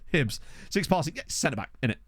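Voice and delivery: ordinary delivery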